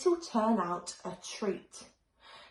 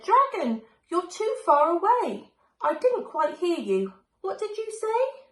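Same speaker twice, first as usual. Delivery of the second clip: higher, louder call upward; 9 semitones higher, 5 dB louder than usual. A narrator's reading. Betty calling to the dragon on the steeple, loud call. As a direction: shout up to someone far off.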